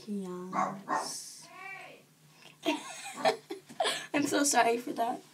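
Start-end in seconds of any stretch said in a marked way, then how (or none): 2.6-3.5 high-pitched giggle; laugh. Real, not acted. Vlogger laughing before apologising to viewers, high giggle. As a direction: burst into a high little laugh.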